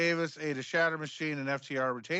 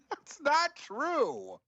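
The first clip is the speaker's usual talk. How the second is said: laughing denial; 11 semitones higher, much slower than usual. Real, not acted.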